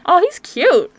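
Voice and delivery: ordinary talk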